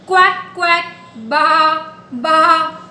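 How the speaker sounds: sing-song quacks then baas; playful animal imitations; duck and sheep sounds. Shown as a demonstration. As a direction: chant the animal noises for toddlers. Repeats animal sounds for game.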